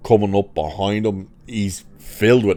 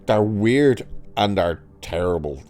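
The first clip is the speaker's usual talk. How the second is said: ordinary delivery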